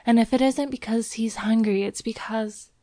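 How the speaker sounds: put-on high girl's voice, uneasy and fading; nervous, faltering. A narrator's reading. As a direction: let her worry falter into silence. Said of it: "she continued nervously".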